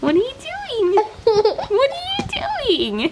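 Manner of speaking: very high laughing question. Real, not acted. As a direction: squeal it through laughter. Laughing at toddler.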